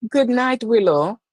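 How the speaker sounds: high, loud cheerful goodnight; bright, high, loud goodnight to a viewer; excited goodnight. Real, not acted. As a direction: sing out goodnight.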